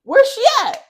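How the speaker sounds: high, loud lilting question; animated questioning. Retold or imitated. Storyteller replaying her own question about Peanut Butter, animated questioning. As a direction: ask it with big swoops up and down in pitch.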